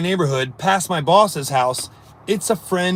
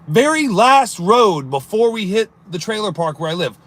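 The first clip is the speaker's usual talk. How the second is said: normal talking voice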